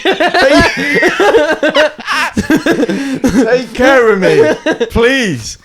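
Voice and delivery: hammed-up adoring plea, laughing; mock swoon. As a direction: camp it up, begging to be pampered.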